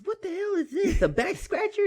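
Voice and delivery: put-on very high, squeaky confused kid; baffled kid. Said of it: "And he goes".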